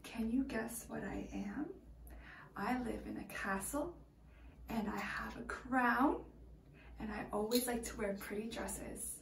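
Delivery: hushed riddle whisper. Acted. Whispering a guessing riddle to the kids. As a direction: lean in and whisper the clues.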